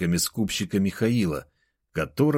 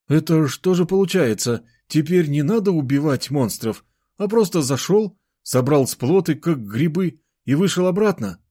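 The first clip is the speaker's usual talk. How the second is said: raised pitch, puzzled questioning; surprised; 8 semitones higher, 4 dB louder than usual. A narrator's reading. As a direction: marvel out loud, pitched up. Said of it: "удивился Кирилл". (удивлённо, повышенным голосом)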